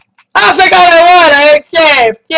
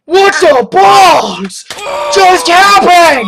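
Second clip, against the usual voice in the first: drawn-out shocked shout, a bit higher; shocked yell; 4 semitones higher, much slower than usual. Real, not acted. Player yelling at a glitch that just happened, drawn-out shocked shout. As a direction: stretch it out in disbelief.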